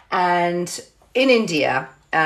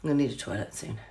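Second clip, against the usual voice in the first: much softer and lower, urgent and strained; pleading under the breath for a toilet; desperate murmur; 6 semitones lower, 13 dB quieter, much faster than usual. Retold or imitated. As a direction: mutter it like you're about to burst. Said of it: "I'm like".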